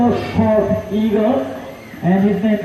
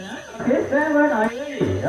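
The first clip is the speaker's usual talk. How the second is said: ordinary talk, nothing marked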